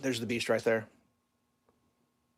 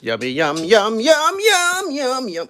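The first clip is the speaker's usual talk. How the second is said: much higher, loud cooing yums; sing-song yum; 9 semitones higher, 9 dB louder, much slower than usual. Real, not acted. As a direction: cheer the dog on while it eats. Cooing at dog eating.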